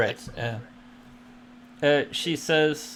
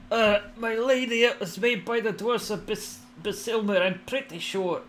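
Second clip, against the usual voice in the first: raised, eager dwarf; dwarf character voice; 9 semitones higher than usual. Acted. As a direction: answer the lady respectfully. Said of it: "he says".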